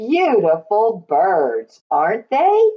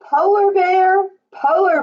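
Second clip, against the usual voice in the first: sing-song refrain; 12 semitones higher than usual. Acted. Read-aloud refrain to child.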